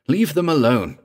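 high, loud protective order; angry command. A narrator's reading. Narrator defending the lovers from Singh, angry command.

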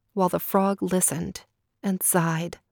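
ordinary delivery, nothing marked

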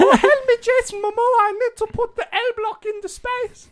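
very high falsetto plea, hammed up; little Dutch schoolboy. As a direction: squeal for help like a tiny schoolboy. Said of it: "to, like, little Dutch schoolboy".

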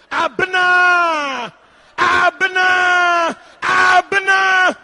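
long, high, very loud calling shout. Acted. Husband calling out when food is late, shouting. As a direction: bellow the name, drawing out the vowels. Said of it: "you're shouting".

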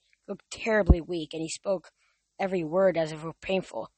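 ordinary delivery

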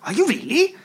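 ordinary talk, nothing marked

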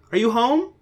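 high, loud mom call; high mock-mom call; mom yelling. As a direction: shout it from the kitchen. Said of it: "her mom yelled from the kitchen".

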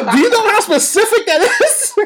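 high-pitched question through real laughter; high, giggling disbelief; laughing incredulously. Real, not acted. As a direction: crack up while asking in disbelief. Cracking up at absurd product.

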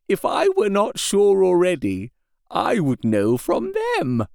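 dawning horror, kept small and weak; agonized confusion. A narrator's reading. Patient realizing his dream was real, slow and anguished. Renfield continues.

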